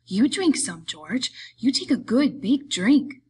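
put-on eager childlike voice, swooping high; happy simpleton. A narrator's reading. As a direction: urge a friend on with simple delight. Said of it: "He smiled happily".

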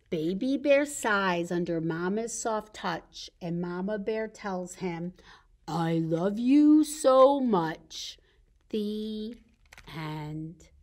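slow, low picture-book ending; story reading to children. A narrator's reading. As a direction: draw out the last lines for toddlers. Reads a picture book to toddlers.

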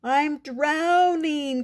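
high, drawn-out cry of alarm. A narrator's reading. Froggy to mother after falling in, crying out. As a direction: wail it as a panicking little frog. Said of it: "cried Froggy".

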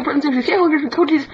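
normal talking voice

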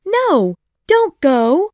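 Wendy calls out to Peter not to leave; crying out. A narrator's reading. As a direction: beg him to stay, voice up high. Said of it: "Wendy cried".

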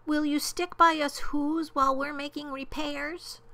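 high, beseeching little voice; tiny mayor's earnest appeal; pleading. A narrator's reading. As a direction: plead for help in a small high pitch. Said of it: "pleaded the voice of the mayor".

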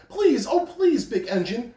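high, pleading little voices; pleading toys. A narrator's reading. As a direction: beg like small toys. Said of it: "cried all the dolls and toys together".

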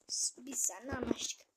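unvoiced whispered deal; conspiratorial whisper. Real, not acted. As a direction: whisper it like a secret pact.

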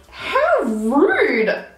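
indignant. Retold or imitated. Reacting to the post office clerk's remark, indignant. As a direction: draw it out in affronted disbelief.

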